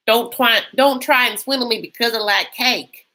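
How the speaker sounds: lightly voiced cheeky warning; quoting a character. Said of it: "she does have a lot of great lines".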